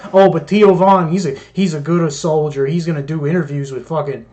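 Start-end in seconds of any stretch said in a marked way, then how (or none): none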